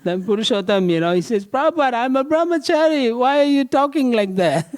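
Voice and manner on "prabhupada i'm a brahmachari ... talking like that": put-on much higher, a young monk half-laughing in protest; flustered protest. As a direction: protest the teasing, embarrassed. Embarrassed monk protests teasing.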